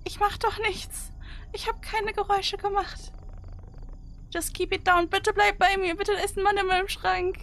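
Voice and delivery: anxious pleading. Real, not acted. Begging Joey about man in wardrobe.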